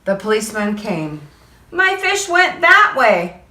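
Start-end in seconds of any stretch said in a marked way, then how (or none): 1.7-3.4 put-on high, slow little boy; slow child's voice. Boy shows policeman, slow high child voice. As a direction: point the way like an anxious boy. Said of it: "I said".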